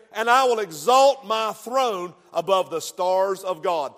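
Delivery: loud, driving pulpit preaching; fiery revival preacher building his point; preaching at full force. Acted. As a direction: hammer each repeated line home to the congregation.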